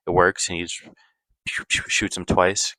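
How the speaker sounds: usual speaking voice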